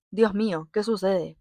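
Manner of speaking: ordinary delivery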